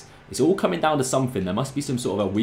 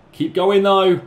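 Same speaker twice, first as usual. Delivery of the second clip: urging on; 8 semitones higher, 6 dB louder, slower than usual. Real, not acted. Urging his player on.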